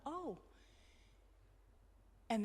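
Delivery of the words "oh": a soft little oh of recognition; quiet wonder, barely voiced above a breath; small surprised oh. Dying mother sees someone in the corner, soft surprise. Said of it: "this, like, little surprised look".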